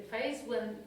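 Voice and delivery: ordinary talk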